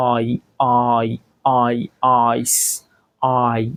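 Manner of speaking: "আই" drawn out lightly, gliding into i; lengthened letter I. Shown as a demonstration. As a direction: stretch the vowel of "আই" a little. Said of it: "একটু লম্বা করে". ("আই" একটু লম্বা করে, হালকা)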